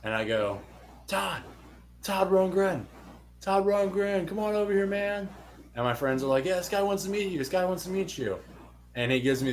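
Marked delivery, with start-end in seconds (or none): 1.1-5.3 high, raised call across the street. Retold or imitated. Fan calling out to star. 6.4-8.4 high excited call to a passing star; a hint of friends hyping him up. Friends calling out to Todd.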